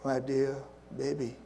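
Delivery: soft, tender fatherly voice; a father softly calling his bitter son dear baby; gentle word. Acted. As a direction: coax the son back gently. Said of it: "the father reaches out with a gentle word".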